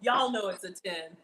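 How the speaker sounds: ordinary delivery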